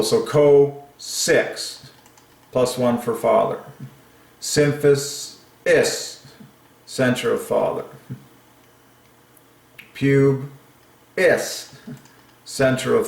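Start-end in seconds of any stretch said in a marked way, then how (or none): 0.3-1.9 "co, six" pitched up in two separate beats; syllable by syllable. Shown as a demonstration. Splitting coccyx into its syllables. 4.4-6.3 "Symphis", then a high, separate "is"; last syllable split off. Shown as a demonstration. 9.9-11.8 "Pube", pause, high "is"; syllable by syllable. Shown as a demonstration. Splitting pubis into its syllables.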